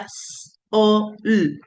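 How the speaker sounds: "s", "o", "l" as separate drawn-out sounds; sounding out phonemes. Shown as a demonstration. Teacher showing students every phoneme in a word, sounded out separately. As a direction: sound out "s", "o", "l" one phoneme at a time. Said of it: "como yo les decía".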